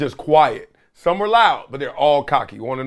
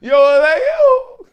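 slow, much higher and louder, half laughing cry; celebratory shout; 14 semitones higher, 5 dB louder, slower than usual. Pretend. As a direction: let it out like a wild celebration. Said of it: "if I can't go".

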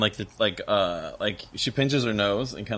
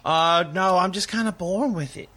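higher, airy shrug of a reply; Esther's voice; 8 semitones higher than usual. Acted. Esther tells the students she was born with it, pitched up. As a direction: breezily brush it off, pitched up. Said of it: "Esther voiced by GM".

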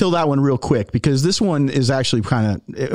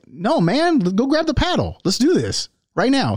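much higher, eager Ronnie voice; Ronnie Coleman impression; 9 semitones higher than usual. Ronnie urging his friend to play right now, high imitated voice. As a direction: play Ronnie keen to grab the paddle, pitched up. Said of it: "my Ronnie Coleman accent".

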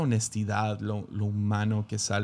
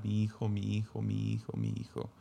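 grieving cry; 7 dB quieter than usual. Retold or imitated. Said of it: "empieza a gritar".

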